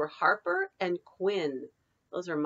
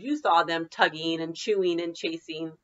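usual speaking voice

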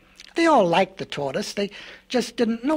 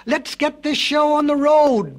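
loud, slow, raised ox bellow; 7 semitones higher, 5 dB louder than usual. A narrator's reading. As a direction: bellow it out to start things. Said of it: "bellowed the ox".

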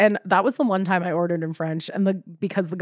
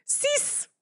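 much higher, much softer squeak; tiny nervous voice; 8 dB quieter than usual. Retold or imitated. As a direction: squeak the order out timidly. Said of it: "So I was like".

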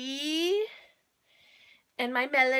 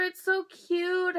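ordinary talk, nothing marked

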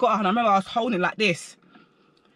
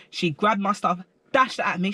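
ordinary delivery, nothing marked

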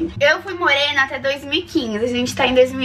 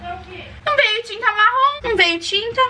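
whiny complaint; 8 semitones higher, slower than usual. Real, not acted. Complains to mother about missing dye.

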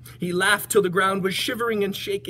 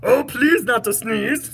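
loud, high, slow bear plea; pleading bear; 7 semitones higher, 7 dB louder, slower than usual. Acted. Bear begs the elephant not to sneeze, loud plea. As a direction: beg loudly as an upset bear. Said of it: "said the bear".

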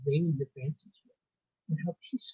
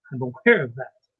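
louder, dry, grim reply; rather grim; 3 semitones lower, 8 dB louder than usual. A narrator's reading. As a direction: answer grimly as the doctor. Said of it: "he answered rather grimly".

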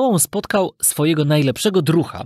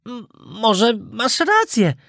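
slow, high, stammered start; hesitant puppet; 7 semitones higher, much slower than usual. A narrator's reading. Puppet half persuaded by his friend, hesitant. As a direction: give in haltingly, drawing it out high.

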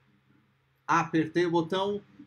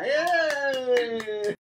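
loud happy whoop; celebratory cheer; 6 semitones higher, 6 dB louder than usual. Real, not acted. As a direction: cheer for the winner. Cheer after naming winner.

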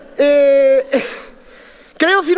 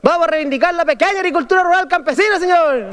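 rural Chilean campesino accent; 6 semitones higher than usual. Shown as a demonstration. Delegate reclaims his rural Chilean accent before the convention. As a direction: repeat it higher, in a rural Chilean country accent. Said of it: "perdón".

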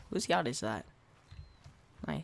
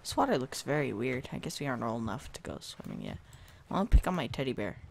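normal talking voice

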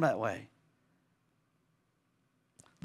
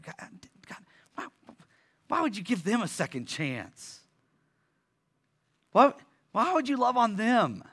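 whiny complaint to God; 7 semitones higher than usual. Retold or imitated. Voicing a jealous believer.

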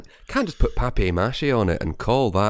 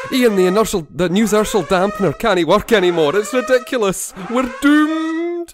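much higher, louder hammed-up Scottish engineer; a Scotty-style Scottish engineer in a panic; bad Scottish accent; 13 semitones higher, 6 dB louder than usual. Co-host to host, a hammy Scottish engineer's panic. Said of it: "become Scotty meets Dad's Army".

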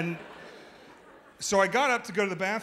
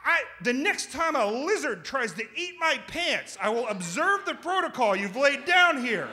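high, loud, exasperated retort; comic snaps back at his roommate, high and loud; snapping back; 7 semitones higher, 4 dB louder than usual. Retold or imitated. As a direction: finally lose patience and snap. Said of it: "that's when I snapped".

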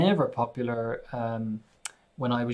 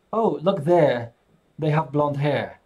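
demonstrated English accent with open R-less endings; 5 dB louder than usual. Shown as a demonstration. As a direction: put on an English accent, dropping the R in "there" and "hair". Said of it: "I'm just not pronouncing the R".

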